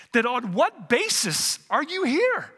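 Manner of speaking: slow, emphatic mock exasperation; frustrated. Supervisor angel demanding the robber's grounds for entry, frustrated. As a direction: spell out the question with big pitch swings.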